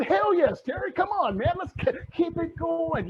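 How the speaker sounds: high-pitched, excited audience reply; eager cheering. Acted. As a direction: play a fired-up fan urging the coach on. Voices audience's eager yes.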